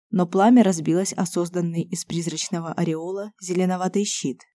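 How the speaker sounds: ordinary delivery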